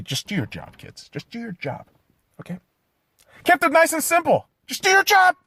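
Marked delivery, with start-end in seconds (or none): 0.0-2.6 soft urging. Real, not acted. Repeats advice softly then loudly. 4.7-5.4 high, pushed-out order; emphatic command. Real, not acted. Repeats advice softly then loudly.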